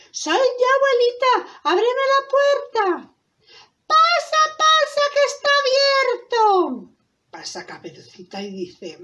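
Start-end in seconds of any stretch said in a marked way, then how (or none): 0.1-2.8 put-on high, lively little-girl voice. Girl at grandmother's door, high child voice. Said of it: "Y dice Caperucita". 3.9-6.4 very high, loud mock-granny call; wolf as grandmother. Wolf luring the girl inside, high fake granny voice. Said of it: "engañando a Caperucita".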